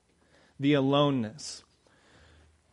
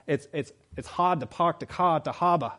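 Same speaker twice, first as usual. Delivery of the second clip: put-on dropped-r Boston accent; 3 semitones higher than usual. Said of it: "someone say".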